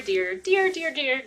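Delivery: gushing. Acted. Mock-gushing repeated endearment.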